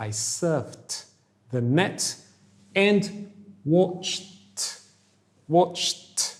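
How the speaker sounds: "watched" said as "watcht", t at the end. Shown as a demonstration. Teacher models "watched" ending in t for students. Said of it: "ćemo izgovoriti kao T".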